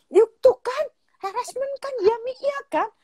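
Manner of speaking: very high imitated girl's squeal; vindicated daughter. Daughter presses mother to agree it's harassment, high mock squeal. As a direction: pipe up as a child proved right.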